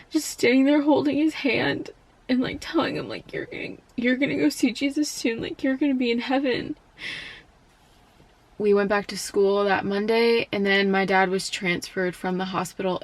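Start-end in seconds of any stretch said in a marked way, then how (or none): 0.1-6.8 tearful. Real, not acted. Tearful memory of dying father.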